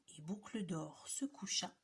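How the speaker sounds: softly, like not to wake her; hushed whisper. A narrator's reading. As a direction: whisper as she lies down. Falling asleep, hushed.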